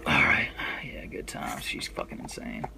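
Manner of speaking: hushed whispered aside; whispering. Real, not acted. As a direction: whisper it to yourself. Unvoiced aside.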